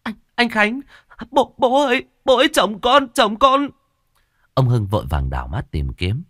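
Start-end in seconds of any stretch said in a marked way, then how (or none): 0.0-3.7 very high, loud wailing cry; wife wailing to her father-in-law over her husband. Acted. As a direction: wail in anguish for your husband. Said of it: "con dâu khóc thét lên".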